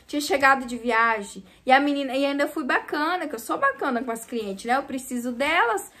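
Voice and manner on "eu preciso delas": usual speaking voice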